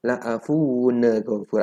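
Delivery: "La'afuwun ghafur" slurred as la'afuwu-ne-ghafur; "La'afuwun" smeared slowly into "ghafur" with an added ne; dragged, faulty recitation. Shown as a demonstration. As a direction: drag the end of "La'afuwun" into an extra ne. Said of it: "diseret gitu ya".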